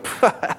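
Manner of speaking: brief acted puff of derision; scoffing laugh. Hearers laughing at someone sharing the gospel, scoffing. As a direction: blow out a short mocking laugh. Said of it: "they start to laugh at you".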